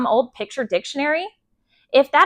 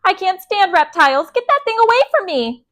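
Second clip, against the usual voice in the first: high, loud horrified shriek; panicked high-pitched outburst; 9 semitones higher, 6 dB louder than usual. A narrator's reading. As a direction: shriek in disgust and alarm. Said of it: "Missus Yarby shrieked".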